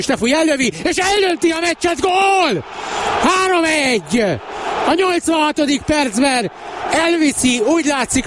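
high excited commentator call; excited goal call. Real, not acted. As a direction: shout the goal.

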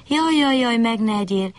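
high, whimpering jaj jaj; frightened bunny begging, wailing high. Acted. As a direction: wail it like a terrified little rabbit. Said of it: "siránkozott a nyuszi".

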